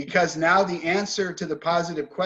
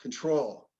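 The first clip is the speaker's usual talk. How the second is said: "Control" with weak first syllable, stressed TROL; second-syllable stress; 3 semitones lower, 5 dB quieter than usual. Shown as a demonstration. Teacher asks class where the stress is, stressing conTROL. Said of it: "Where's the stress on that?".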